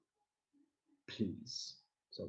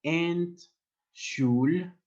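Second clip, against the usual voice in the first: slow model word; 7 semitones higher, 5 dB louder, much slower than usual. Shown as a demonstration. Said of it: "hard to pronounce".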